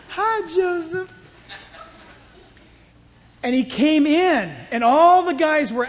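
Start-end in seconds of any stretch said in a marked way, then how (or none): 0.1-1.1 slow, high-pitched come-on; flirty Potiphar's wife. Acted. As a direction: draw out a high, coquettish greeting. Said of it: "she's casting long eyes at him".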